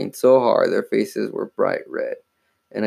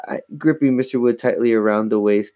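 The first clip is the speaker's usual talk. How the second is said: plain reading voice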